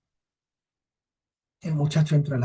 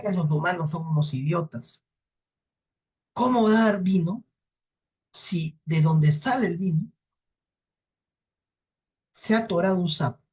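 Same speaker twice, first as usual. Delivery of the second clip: devil's contemptuous explanation, pitched up; scornful devil; 5 semitones higher than usual. Acted. Devil mocks the humans.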